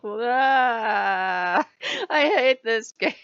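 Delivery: loud hammed-up wail; high, loud comic sobbing complaint; mock bawling. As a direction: bawl in exaggerated despair, laughing through it. Mock-crying reaction to game's ending.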